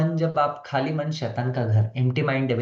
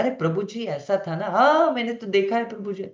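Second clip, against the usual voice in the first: higher, eager butting-in voice; interrupting devotee; 6 semitones higher than usual. Retold or imitated. Teacher mimicking a devotee who interrupts lectures, eager higher voice. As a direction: chirp in like a know-it-all interrupting the lecture. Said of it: "lecture के बीच बीच में भी अपना opinion देते हैं".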